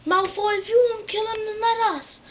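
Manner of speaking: high, jeering demand; a hint of sneering villains; taunting Death Eaters. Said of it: "all the Death Eaters are like".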